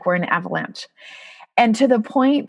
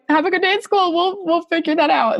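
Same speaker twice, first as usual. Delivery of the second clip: higher, bright voice masking crying; forced cheer through tears; 9 semitones higher than usual. Retold or imitated. Mom sending son to school while crying, forced bright voice. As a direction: sound upbeat while holding back tears. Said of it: "I start crying".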